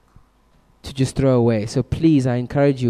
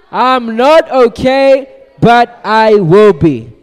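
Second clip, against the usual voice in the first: slow, high, loud chant; drawn-out rallying shout; shouting with exclamation marks; 7 semitones higher, 9 dB louder, much slower than usual. Shown as a demonstration. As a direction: shout it out to lead the crowd. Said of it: "It's when you're shouting".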